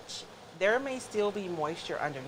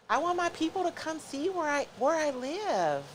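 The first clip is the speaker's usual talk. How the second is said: whiny voice; 8 semitones higher than usual. Acted. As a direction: whine it like a sulky kid. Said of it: "in my whiny voice".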